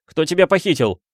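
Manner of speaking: high, agitated demand; urgent high-pitched questioning; crying out. A narrator's reading. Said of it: "вскричал Адам Каэтан Петрович".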